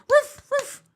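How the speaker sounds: very high, loud yapping imitation; lap dog bark. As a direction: yap like an eager little lap dog. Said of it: "being the lap dog".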